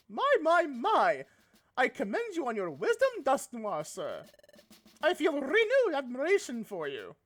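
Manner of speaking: a mock posh British accent, lightly done; British Dusknoir voice. Dusknoir praises his partner in a hammed-up British accent. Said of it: "I like this voice I gave Dusknoir".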